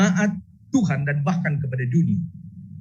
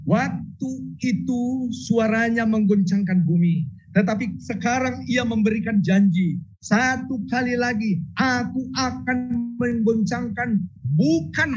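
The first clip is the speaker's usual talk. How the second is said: scripture reading; 6 semitones higher than usual. Acted.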